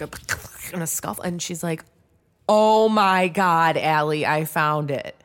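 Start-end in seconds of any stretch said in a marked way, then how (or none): 2.5-5.2 loud, slow, drawn-out exclamation; astonished announcement. Retold or imitated. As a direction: imitate a friend announcing a huge find. Acting friend's astonished discovery.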